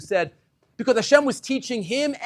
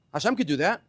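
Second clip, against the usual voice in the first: usual speaking voice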